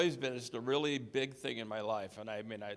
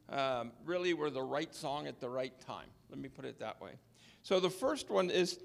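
normal talking voice

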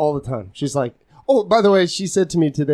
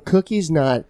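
ordinary delivery

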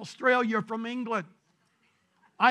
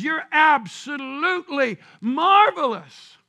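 full-force preaching; 2 semitones higher, 8 dB louder, slower than usual. Real, not acted. Preacher telling people God calls them marvelous, full force. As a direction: proclaim each word big and slow to the congregation.

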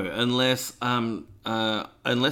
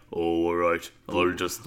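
character voice; 4 semitones lower than usual. Retold or imitated. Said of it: "most of the character voices say things like".

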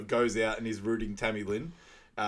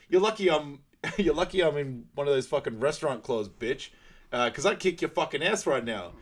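louder, higher threatening yell; mock angry threat; 5 semitones higher, 5 dB louder than usual. Guy threatening a fight at restaurant, angry. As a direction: threaten to kick his ass.

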